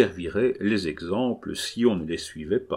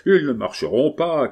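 raised, loud contradiction; higher, louder cry of a rival crowd; crowd shout back; 6 semitones higher, 6 dB louder than usual. A narrator's reading. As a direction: shout back at the other side. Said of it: "criaient les autres".

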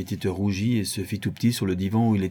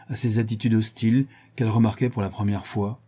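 ordinary delivery

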